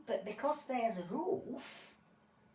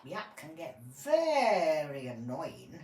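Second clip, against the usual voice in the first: ordinary talk, nothing marked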